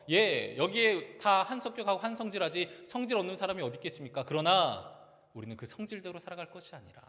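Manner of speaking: loud, high, urgent exhortation; preaching at full force. Acted. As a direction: drive the point home to the congregation. Preaching climax. (힘차게 외치는 설교)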